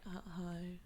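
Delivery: soft, low, barely voiced greeting; listless receptionist. Receptionist answering a customer's insurance call, listless and soft. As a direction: mumble an unenthusiastic hello, almost whispered. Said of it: "talk to somebody that's like".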